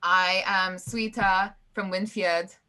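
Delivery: slow, hammed-up Nordic accent; mock Swedish accent. Diplomat retrying her introduction, slow mock Swedish accent. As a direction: spell it out in a halting Swedish accent. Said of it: "are we just all from Sweden?".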